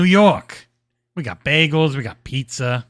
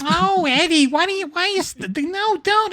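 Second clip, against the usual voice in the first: much higher, whiny plea; high mock pleading; 11 semitones higher than usual. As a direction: beg him hammily to stay. Acting Wallis pleading.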